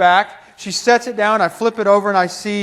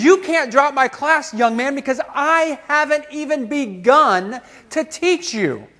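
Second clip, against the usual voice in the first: higher-pitched, fervent mock scolding; impassioned insistence; 6 semitones higher than usual. Professor refuses to let failing student quit, impassioned. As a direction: insist with fervour, pitch raised.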